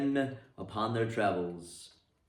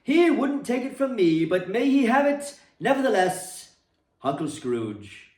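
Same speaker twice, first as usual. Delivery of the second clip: a lightly voiced, higher, louder hearty nephew; hearty toast; 7 semitones higher, 4 dB louder than usual. A narrator's reading. Fred toasting his absent uncle at the party, hearty. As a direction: raise a glass and call it out. Fred's toast to the old man.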